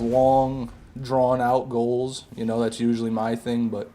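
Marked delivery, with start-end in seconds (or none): 0.0-2.3 drawn out. Acted. Stretches words about drawn-out goals.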